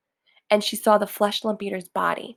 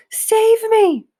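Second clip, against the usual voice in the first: high, loud terrified giant's scream; a dreaming giant begs for rescue; 14 semitones higher, 8 dB louder than usual. A narrator's reading. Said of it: "screamed the flesh lump eater".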